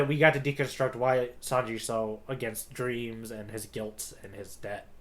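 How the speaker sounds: ordinary talk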